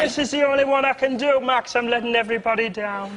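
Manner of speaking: high, strained comic put-on voice; prostitute failing the voice. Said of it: "the prostitute who couldn't do the voice".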